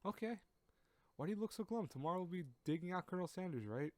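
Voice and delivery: put-on high-pitched girl voice; upbeat high-voiced girl; cheerful girl character. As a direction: coax a friend in a high, light voice. Voices Rena in visual novel.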